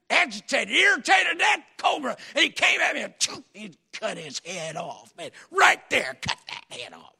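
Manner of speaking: rising to a shout at the climax; excited shouting. Retold or imitated. Preacher retelling the cobra kill, shouting. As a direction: belt out the cobra fight.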